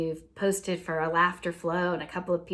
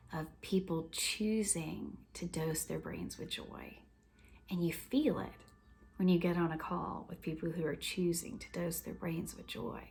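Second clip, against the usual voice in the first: tender hush; 8 dB quieter than usual. Real, not acted. Closing, tender and hushed.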